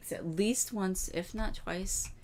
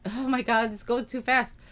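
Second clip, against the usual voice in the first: startled, loud and high; flustered; 7 semitones higher, 7 dB louder than usual. Real, not acted. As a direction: caught off guard by the speed. Reacting to game moving quickly.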